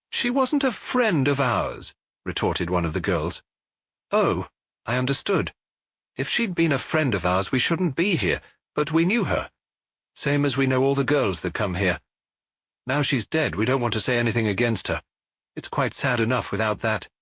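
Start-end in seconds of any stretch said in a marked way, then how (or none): 0.1-1.9 put-on slow, higher retort from a bar girl; a hint of a prickly young woman setting him straight; lightly voiced girl retorting. 4.1-17.1 put-on a bit higher young woman, earnest and guarded; a hint of a girl defending a dead acquaintance; lightly voiced bar girl. Girl explains about the dead girl.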